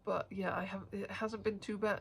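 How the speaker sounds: ordinary talk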